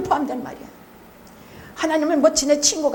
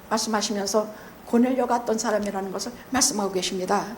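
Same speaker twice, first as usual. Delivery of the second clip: usual speaking voice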